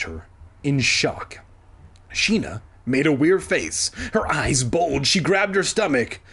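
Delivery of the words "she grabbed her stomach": plain reading voice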